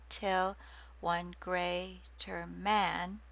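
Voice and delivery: slow, each iambic stress leaned on; slow iambic reading. Shown as a demonstration. Lecturer reading Milton for students to hear stresses, slow and metered. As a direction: read Milton so the five stresses ring out. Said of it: "Listen for the five stresses".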